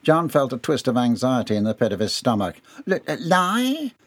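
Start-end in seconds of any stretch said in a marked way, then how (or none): 2.9-3.9 high, slow, stuttering question; nervous stammer. A narrator's reading. Employee accused of lying by his boss, nervous stammer. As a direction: stumble into the word, caught out.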